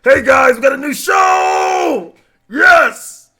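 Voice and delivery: loud, high, whooping hype; dude bro voice. Acted. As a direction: shout like an over-pumped bro cheering a game reveal. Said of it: "what dude bro voice?".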